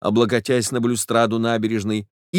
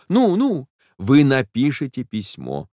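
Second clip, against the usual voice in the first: ordinary delivery, nothing marked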